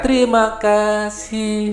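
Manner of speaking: Terima kasih sung slowly and high, swelling; teacher shows crescendo on Terima. Shown as a demonstration. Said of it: "ini kan membesar kan".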